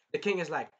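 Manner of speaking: ordinary talk, nothing marked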